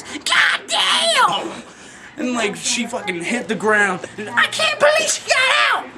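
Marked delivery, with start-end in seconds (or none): 0.0-1.6 high, shrill imitated yell; mock yell. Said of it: "All you hear the woman yell is". 4.3-5.8 high imitated shout; mock shout. Voicing woman yelling after hit.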